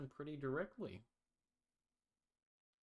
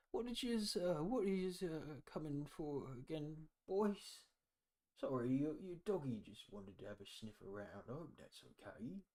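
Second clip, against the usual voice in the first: put-on high, creaky old man asking the boys; a doddery old villager squinting at strangers; lightly voiced old man, high and quavery; 5 semitones higher than usual.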